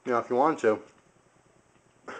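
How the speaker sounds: slow, talking through a mouthful; chewing while trying to speak; mouth full. Real, not acted. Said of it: "sorry, my mouth's full again".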